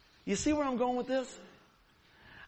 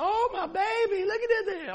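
fussing mom; 12 semitones higher, 4 dB louder than usual. Retold or imitated. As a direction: coo over the hurt child like a worried mom. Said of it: "And my mom said".

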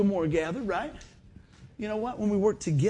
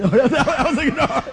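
laughing no; 6 semitones higher, 8 dB louder, slower than usual. Real, not acted. Laughing refusal of wife's idea.

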